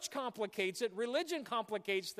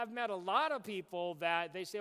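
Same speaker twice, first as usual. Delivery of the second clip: ordinary talk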